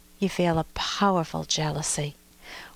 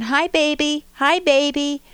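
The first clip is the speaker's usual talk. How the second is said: high, loud, impatient call; frustrated toddler; 6 semitones higher, 6 dB louder than usual. Retold or imitated. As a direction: call again, now getting frustrated. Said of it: "to frustration".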